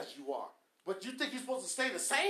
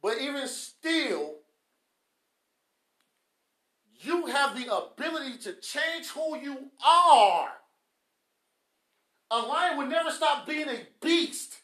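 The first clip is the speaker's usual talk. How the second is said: slow, raised, drawn-out exhortation; emphatic preaching; 4 semitones higher, 8 dB louder, slower than usual. Real, not acted. Preacher telling listeners they can change, emphatic slow preaching. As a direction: stretch the words and send them out high.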